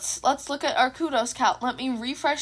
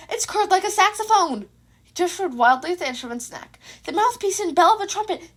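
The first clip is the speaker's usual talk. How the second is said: indignant rant; 5 semitones higher than usual. Acted. Izuku indignantly explaining his instrument.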